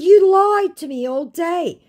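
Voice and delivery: high, loud, slow accusation; high angry accusation. Retold or imitated. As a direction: hurl the accusation. Accusing scammer.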